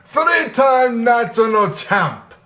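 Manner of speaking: slow, high, hammed-up Russian accent; mock a cheesy Russian promo in a bad Russian accent. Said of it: "that was a terrible Russian accent".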